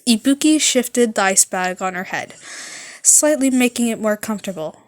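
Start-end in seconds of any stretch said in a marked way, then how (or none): none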